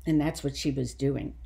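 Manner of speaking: plain reading voice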